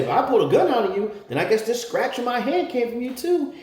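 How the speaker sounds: fast, high, loud furious comeback; angry retort. Retold or imitated. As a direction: fire it back at her. Angry retort about the scratch.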